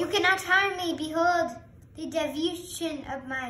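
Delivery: loud, high creepy villain voice. Acted. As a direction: boom it out like a cult leader. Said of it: "You say it in a creepy voice".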